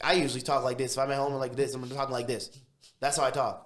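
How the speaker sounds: loud, punchy self-demonstration; brash city talk. Shown as a demonstration. City guy shows how he talks at home, loud and brash. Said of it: "We are very brash speaking".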